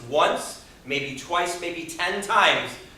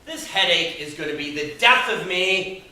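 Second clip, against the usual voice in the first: weary groaning complaint; 3 semitones higher, 5 dB louder than usual. Acted. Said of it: "You ever find yourself saying things like this".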